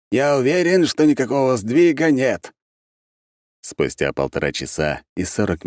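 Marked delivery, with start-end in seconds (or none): 0.1-2.5 high, loud, certain denial; insistent. A narrator's reading. Архимаг denies the border moved, insistent. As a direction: insist firmly with raised pitch.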